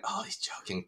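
hushed, under the breath; half-whispered to yourself; quiet inner voice. Retold or imitated. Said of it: "I went".

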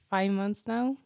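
ordinary talk, nothing marked

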